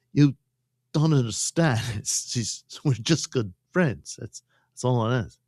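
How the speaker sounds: a hint of a lying boyfriend, excuses spilling out; lightly voiced con man pleading innocence; smooth-talking cheater caught out. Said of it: "And he's like".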